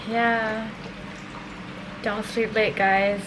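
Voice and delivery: ordinary delivery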